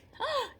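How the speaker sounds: sharp high gasp of wonder; amazed gasp. Acted. Teacher gasping in wonder to the class about flounder eyes. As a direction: gasp as if you can't believe it.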